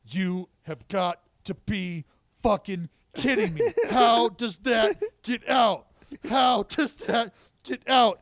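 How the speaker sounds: loud, high, disbelieving shout; furious off-air yell. Retold or imitated. Announcer venting off-air at a game-changing home run, loud disbelieving yell. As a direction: bellow it like the game just got ripped away. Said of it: "and I just went".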